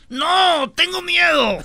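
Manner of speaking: hammed-up scared husband; mock scared husband. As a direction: refuse to look, half scared. Husband afraid to look.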